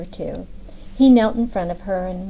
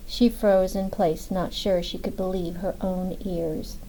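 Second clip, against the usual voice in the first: usual speaking voice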